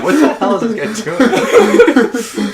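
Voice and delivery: high, slow, laughing bewildered question; baffled onlooker cracking up; laughing disbelief. Retold or imitated. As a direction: spit it out through laughter.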